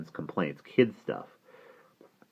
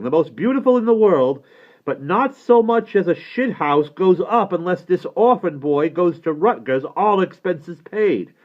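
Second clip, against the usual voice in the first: loud, high browbeating demand; bully them down the phone, no argument allowed; shouting; 11 semitones higher, 8 dB louder than usual. Acted. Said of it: "starts shouting at him".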